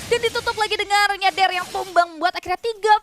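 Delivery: loud fast play-by-play; esports caster. Real, not acted. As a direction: rattle off the teamfight, loud.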